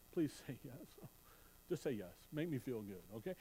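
soft, teasing plea for a yes; playful quiet plea. Pretend. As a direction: coax them gently, half-joking. Coaxing the congregation for a yes.

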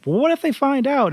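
high-pitched nervous fairy; shrill voice. Retold or imitated. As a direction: imitate a shrill, fretful fairy. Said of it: "the shrill voices".

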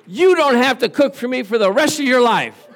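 high, loud retold proclamation; hammed-up grateful outburst; elated declaration. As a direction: declare it big, for comic effect. Overjoyed husband declaring to wife.

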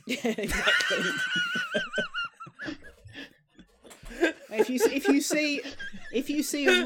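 laughing loudly while talking; words bursting through laughter; high, loud laughing talk. Real, not acted.

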